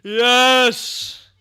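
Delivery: loud, high shout of joy; excited cheer. Real, not acted. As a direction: cheer him on at full volume. Cheering as Gandalf arrives.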